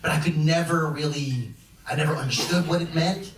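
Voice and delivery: usual speaking voice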